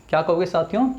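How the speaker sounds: normal talking voice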